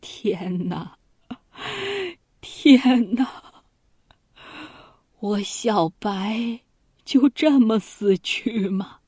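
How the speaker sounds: a touch of tears on 天哪, then slow and drawn out on 我小白; tearful lament. A narrator's reading. Dying duke alone in his sickroom, lightly tearful lament. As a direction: sigh out a slow, mildly tearful cry to heaven. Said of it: "齐桓公流着眼泪，叹着气说".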